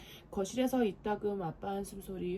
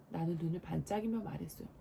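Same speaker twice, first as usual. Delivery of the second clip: ordinary delivery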